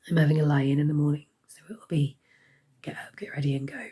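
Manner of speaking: hoarse whisper. Real, not acted. Sick vlogger with failing voice.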